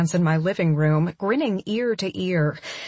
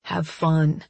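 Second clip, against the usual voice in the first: usual speaking voice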